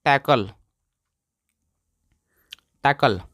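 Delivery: "टैकल" repeated as a short model word, falling; pronunciation demo. Shown as a demonstration. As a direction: drill "टैकल" with a pause after it. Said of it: "क्या पढ़ते हैं दोस्तों?".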